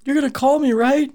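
high, pleading clingy voice; needy lover voice. Pretend. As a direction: beg like a jilted one-night stand. Voicing the abandoned block.

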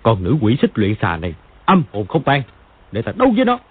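put-on deep growling old man's voice; furious old man. A narrator's reading. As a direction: spit out the challenge angrily. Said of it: "cả giận".

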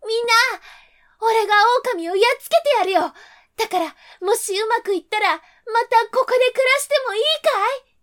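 loud, high, fast eager call. A narrator's reading. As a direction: shout to the whole village. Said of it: "大声で言いました".

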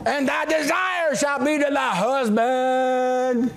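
drawn-out, held notes on a teasing verse; playful crowing over the congregation; gloating. Acted. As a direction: stretch the words out in mock-solemn relish. Gleefully reads his favourite verse at wives.

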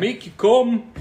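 high, loud Jamaican voice; cartoonish Jamaican accent. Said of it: "doing a great Jamaican accent".

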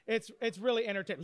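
ordinary talk

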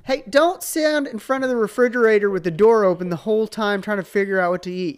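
high, loud scolding dad voice; hammed-up father complaining about house rules; nagging dad. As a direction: call out a mock dad telling-off. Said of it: "when Dad gets involved, he's all like".

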